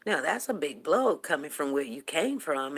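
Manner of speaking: ordinary talk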